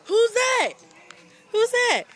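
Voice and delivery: high, eager, coaxing. Real, not acted. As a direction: lift it up, delighted. Excited coaxing of the bird.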